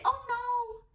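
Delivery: a playful, exaggerated oh no; mock dismay. Shown as a demonstration.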